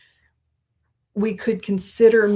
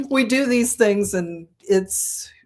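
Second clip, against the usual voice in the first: talking while drumming on table; drumming the table mid-sentence; banging the desk; 5 semitones higher, 4 dB louder than usual. Real, not acted. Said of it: "quit hitting the table".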